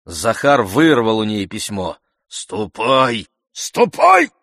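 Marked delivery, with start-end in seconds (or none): 3.5-4.3 loud yell leaping very high; shouted order. A narrator's reading. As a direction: drive her away with a sudden high shout. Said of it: "закричал он".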